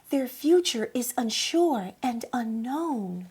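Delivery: put-on gentle rhyming storybook read for children; story narration. Storyteller reading a rhyming book to children, gentle.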